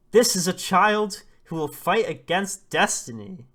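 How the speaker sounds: high, very loud heroic cry; screamed proclamation. Acted. Dada raises the baby to the sun, screamed proclamation. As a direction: shout it out as a rousing declaration. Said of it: "He's screaming and crying".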